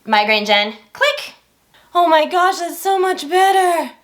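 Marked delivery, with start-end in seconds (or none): 0.9-1.3 sharp high click sound; switch click. Retold or imitated. Telling viewers how the light came on, mock click. 1.9-3.9 huge relief. Retold or imitated. Wife in the bathroom as the light comes on, relieved.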